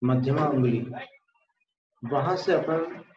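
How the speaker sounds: ordinary talk, nothing marked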